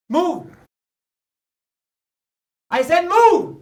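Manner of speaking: high, rising shouted order; angry command. Acted. Ordering rival away, escalating.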